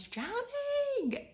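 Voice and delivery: high, stretched-out "drowning"; dramatized wail. Retold or imitated. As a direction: draw out "drowning" in a high pitch. Dramatizing her own drowning.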